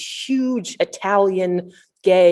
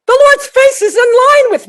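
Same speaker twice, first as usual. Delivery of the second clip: mock scream; 9 dB louder than usual. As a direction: shriek it as a frantic friend. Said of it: "scream texts".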